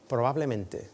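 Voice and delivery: ordinary talk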